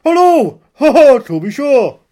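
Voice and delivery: high, loud stage-Irish greeting; hammed-up Irish accent. Shown as a demonstration. Actor showing off his Brooklyn character, hammed-up Irish. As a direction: burst into a cartoon Irish accent. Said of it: "my impersonation of the character in Brooklyn".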